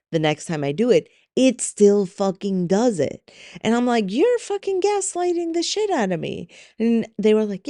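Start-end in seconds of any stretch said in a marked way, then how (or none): 4.1-6.5 much higher, strained accusation; exasperated outburst. Retold or imitated. Snapping at the chatbot that ignores her, high exasperated outburst. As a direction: fling the accusation at the machine.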